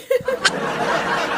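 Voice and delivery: bursting into a high airy laugh. Real, not acted. Host fumbling with live buttons, laughing high and breathy. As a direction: laugh at your own fumbling.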